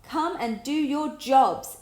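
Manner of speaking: yelling at the lazy bear; yelled. A narrator's reading. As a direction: bellow the full name slowly. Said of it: "yelled Tom".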